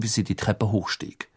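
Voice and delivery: ordinary delivery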